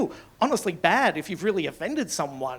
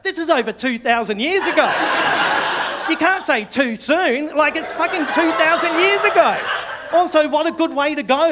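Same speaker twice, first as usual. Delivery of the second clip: loud, high comic outrage; indignant rant; 7 semitones higher, 6 dB louder than usual. Acted. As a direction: shout down the absurd complaint. Comic outrage rebutting the complaint.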